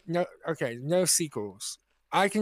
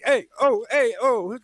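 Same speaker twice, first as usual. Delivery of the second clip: loud, higher caricature Italian-American calls; mocking Italian impression; 5 semitones higher, 5 dB louder than usual. Complaining how Brits mock Italians, loud parody Italian accent. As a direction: imitate a Brit's cartoon Italian. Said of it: "it's always like with the Italians, you're like".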